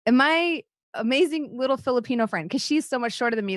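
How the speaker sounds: ordinary talk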